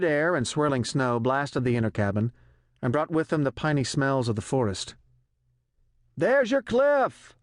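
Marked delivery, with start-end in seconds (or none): 6.2-7.3 much higher, louder, slow drawn-out cry; pilot crying out. A narrator's reading. Pilot alerting the general to the cliff, crying out. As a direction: yell it out, pointing at the cliff. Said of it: "the pilot cried".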